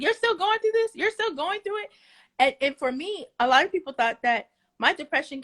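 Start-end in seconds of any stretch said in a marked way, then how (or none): 0.0-1.9 much higher, incredulous mocking question; mock disbelief. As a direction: imitate someone who can't believe you're not over it. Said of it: "they be like".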